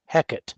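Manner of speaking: Hecate in two syllables, heck-ut. Shown as a demonstration. Reading out the phonetic spelling, Hecate said HECK-ut. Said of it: "is how to pronounce it".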